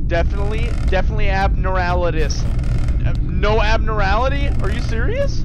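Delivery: loud, frantic shouting; panicked yelling. Real, not acted. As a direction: yell at the screen in a rising panic.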